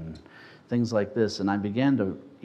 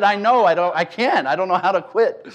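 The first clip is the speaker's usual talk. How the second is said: higher, louder protest; sheepish protest; 7 semitones higher, 6 dB louder than usual. Retold or imitated. Young smoker answering the leader, higher and sheepish. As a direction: admit it a little sheepishly. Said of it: "I said".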